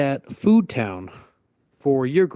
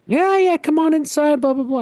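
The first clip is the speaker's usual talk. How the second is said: much higher, breezy mock neighbor voice; imitating the neighbor; 13 semitones higher, 3 dB louder than usual. Old neighbor waving the kid inside, high breezy mock voice.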